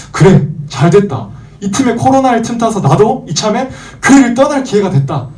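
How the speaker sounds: high-pitched, pleased with the excuse; acted-out sly delight; opportunistic glee. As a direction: seize the chance, voice lifting high. Acts out believer seizing an excuse.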